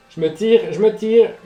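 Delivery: loud, rising exasperation; exasperated shout. Real, not acted. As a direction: snap in growing annoyance. Annoyed by noise.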